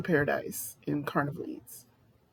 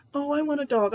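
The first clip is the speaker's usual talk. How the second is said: whiny begging; 8 semitones higher than usual. Retold or imitated. Mocking people who beg for a dog.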